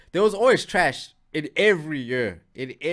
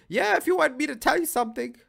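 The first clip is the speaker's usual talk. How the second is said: high mock voice for the reply, higher on yeah; mock Iggy answer; 11 semitones higher, faster than usual. Streamers mocking Iggy's reply, high mock voice. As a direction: parody the odd answer, pitch jumping up. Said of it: "What type of response was that?".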